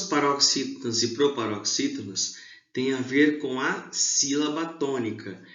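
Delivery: normal talking voice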